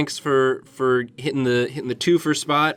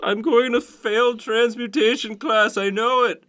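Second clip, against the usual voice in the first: despairing wizard student; 9 semitones higher than usual.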